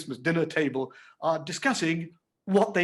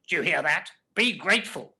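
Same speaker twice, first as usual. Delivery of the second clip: a sister's scolding reproach, pitch lifting; low reproachful tone; 3 semitones higher, 4 dB louder than usual. A narrator's reading. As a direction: reproach the boy, tell him to be grateful. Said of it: "said in a low, reproachful tone".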